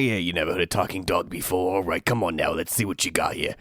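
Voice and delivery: quick, lightly Boston-flavored tough guy; a rough attempt at a Boston voice; Boston accent. Co-host shows how the pug sounds in a loose Boston accent. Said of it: "I can't do Boston, but it's kinda like".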